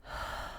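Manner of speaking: soft long exhale; deep sigh. Shown as a demonstration. Nurse breathing with a scared child, slow soft sigh. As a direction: breathe out slowly together.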